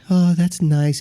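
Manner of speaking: put-on polite, gentle voice; Joe Haas. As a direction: mimic a mild, unimpressed man. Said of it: "very quiet and passive in the way he talks".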